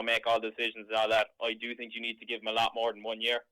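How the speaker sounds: ordinary talk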